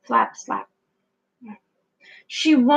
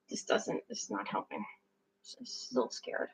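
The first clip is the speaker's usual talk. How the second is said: much softer, frightened whisper; scared whisper; 18 dB quieter than usual. Acted. Frightened Pip whispering that she's still scared.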